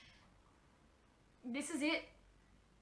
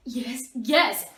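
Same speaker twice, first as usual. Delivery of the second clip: eager; 9 dB louder than usual. Acted. Eager answer to question.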